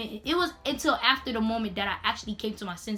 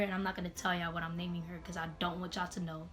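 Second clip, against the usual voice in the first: ordinary delivery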